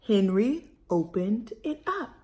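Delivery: usual speaking voice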